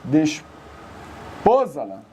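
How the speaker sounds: desposá-la split slowly, pause before a stressed PO; mocked misstressed word. Shown as a demonstration. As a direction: break "desposá-la" as des... POsala. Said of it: "Que coisinha também".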